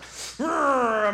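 high drawn-out "rrr" of straining; effortful growl. Retold or imitated. Neighbor pulling taffy with her feet, straining growl. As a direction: imitate straining hard with a long growl. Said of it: "She was like".

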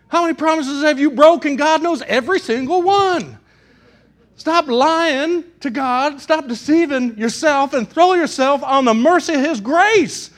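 loud, emphatic pulpit preaching. Real, not acted. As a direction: drive each point home to the congregation. Preaches at full force.

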